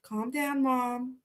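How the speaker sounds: put-on calm her down quietly like a child would; son's quiet pleading; soft soothing. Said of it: "puts a little hand on my arm".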